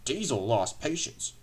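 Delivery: usual speaking voice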